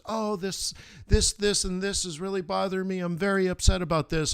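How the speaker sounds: a light imitation of an upset wife. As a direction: whine about what's bothering you. Wife voiced in retold argument.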